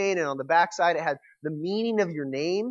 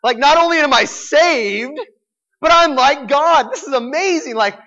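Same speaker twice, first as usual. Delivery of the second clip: put-on higher and louder, a proud kid's delight; proud excitement; 8 semitones higher, 11 dB louder than usual. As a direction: boast like a ten-year-old who just found out. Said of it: "all proud and think I'm like everything".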